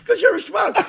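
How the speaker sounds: high, loud laughing punchline; laughing Matthau punchline. Retold or imitated. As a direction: blurt it through a laugh. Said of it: "He goes".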